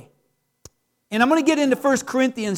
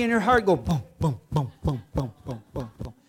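fading boom boom heartbeat, dropping lower and softer; heartbeat imitation; 12 semitones lower, 7 dB quieter than usual. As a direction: let the heartbeat booms fade away to nothing.

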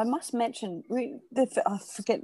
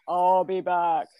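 slow, drawn-out Terminator impression; deadpan movie robot line; 2 semitones lower, much slower than usual. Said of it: "Do you do it in that voice?".

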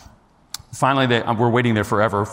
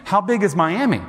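high-pitched incredulous protest; exasperated; 7 semitones higher than usual. Retold or imitated. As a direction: re-enact baffled objection to an absurd suggestion. Retells his exasperated thoughts.

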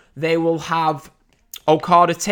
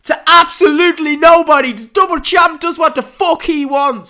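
put-on terrible Irish accent, high and loud; over-the-top mock Irish promo voice; Conor McGregor; 9 semitones higher, 5 dB louder than usual. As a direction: do a hammed-up bad Irish brag. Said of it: "Sorry, terrible Irish accent".

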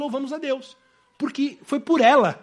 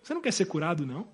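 softer, lower offhand prod; stranger's casual question; 5 semitones lower, 8 dB quieter, faster than usual. Acted. A stranger asks the paralytic beside the pool, offhand. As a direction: ask it lightly, like a passer-by. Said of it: "Chega um homem do lado dele e pergunta".

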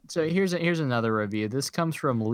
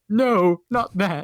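higher, louder cry of alarm; acted-out urgent objection; board member's protest; 7 semitones higher, 5 dB louder, much slower than usual. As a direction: cry out to stop him, mock-horrified. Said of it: "one of the board members stood up and went".

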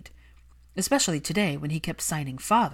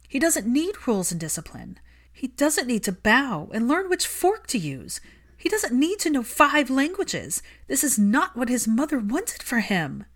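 higher, louder yelling; 8 semitones higher, 5 dB louder than usual. Acted. Hermione yelling at Malfoy about his son. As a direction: yell it for the child's sake. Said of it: "She found herself yelling".

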